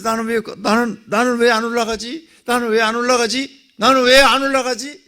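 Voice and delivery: high, loud, desperate questioning; frantic why-not-me cry; panicked cry. Retold or imitated. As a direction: plead in rising panic. Panicked self-question in dream.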